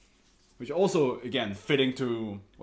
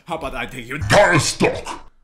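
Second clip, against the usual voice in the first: much louder bold demand; 12 dB louder than usual. Retold or imitated. The buyer ordering everything, loud and bold. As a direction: blurt it out big. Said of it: "And he's like".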